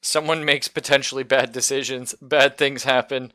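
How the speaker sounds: loud, excited, laughing through the words; 笑いながら興奮して話す. Real, not acted. Laughing over chaotic campaign.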